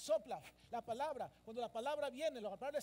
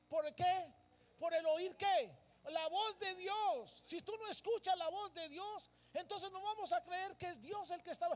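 raised, emphatic preacher's voice; forceful preaching; 5 semitones higher, 5 dB louder than usual. Acted. Preacher telling the church faith comes by hearing, forceful. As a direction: preach it out with high, ringing force.

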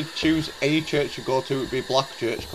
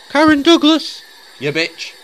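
high, loud call to the ghost; 11 semitones higher, 7 dB louder than usual. Acted. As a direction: call her name out sharply. Calling out the ghost.

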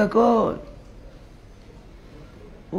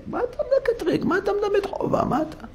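high scolding; 13 semitones higher, 6 dB quieter than usual. Acted. God rebuking the accuser.

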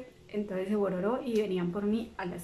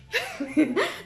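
real laugh; 6 semitones higher than usual. Real, not acted. Laughing at the memory.

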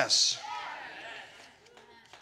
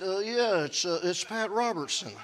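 put-on low, soft, halting old preacher voice; Pat Robertson impression; 4 semitones lower, 6 dB quieter than usual. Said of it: "I imitated Pat Robertson".

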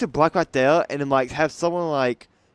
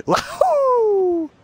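excited whoop; 4 dB louder than usual. Real, not acted. Jumps off during game.

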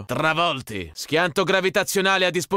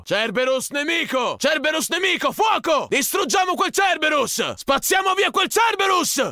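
battle cry; 11 semitones higher than usual. Acted. Soldier urges his squad to destroy the enemy Cerberus, shouting. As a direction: rouse the squad to attack.

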